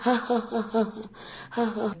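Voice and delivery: playful mock laugh ha ha ha; laughing baby. Shown as a demonstration. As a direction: act out a baby laughing for kids. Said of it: "acting out laughing baby".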